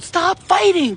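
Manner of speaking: high, loud shouted order; retold scream. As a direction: shout it at the sky to break up a fight. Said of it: "I screamed at them".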